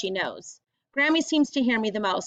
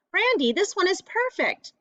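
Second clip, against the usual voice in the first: put-on higher, eager kid voice; excited; 9 semitones higher than usual. Delcy showing Brandy her perfect shell, excited. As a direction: show off the shell proudly.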